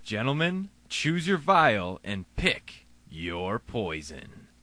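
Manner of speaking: slow, low, sinister invitation; ominous low. Acted. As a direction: draw it out darkly.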